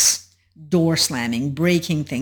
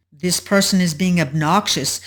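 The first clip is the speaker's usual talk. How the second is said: ordinary delivery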